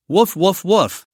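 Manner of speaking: eager, upbeat barks; excited dog barking. Acted. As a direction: yap like a dog hoping for a treat. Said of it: "wagged its tail excitedly".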